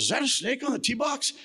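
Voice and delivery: high, fast mock alarm; imitating a startled golfer calling out. Bystander on the tee box, high and fast alarm. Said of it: "somebody goes".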